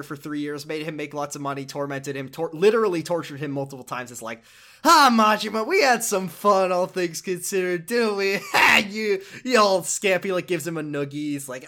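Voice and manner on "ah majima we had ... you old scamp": loud, jovial mock-fond yakuza boss; hammy boss voice. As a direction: boom it like a creepy friendly boss. Shimano voiced fondly reminiscing, retold.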